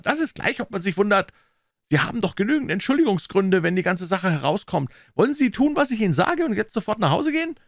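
high, insistent, impatient pressure; pressing businessman, pitch climbing to a demand; commanding banker. Acted. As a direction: override his objections and push him to obey.

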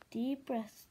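usual speaking voice

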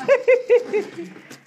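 high, loud cackle; high-pitched laughing fit. Real, not acted. Laughs at her own song parody.

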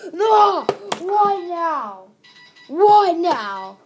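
loud, high, exasperated yell; frustrated yell. Real, not acted. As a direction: cry out in real frustration. Phone rings mid-video, he yells.

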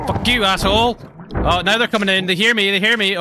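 loud, high yell at an attacker; high-pitched alarmed outburst; startled shouting. Real, not acted. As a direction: shout it in a sudden panic. Intruder bursts in during game.

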